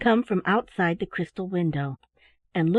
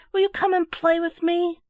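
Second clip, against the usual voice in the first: higher, louder eager plea; lonely boy begs a playmate, higher and louder; eager cry; 9 semitones higher, 4 dB louder than usual. A narrator's reading. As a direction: ask to play, bursting with delight. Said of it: "he cried".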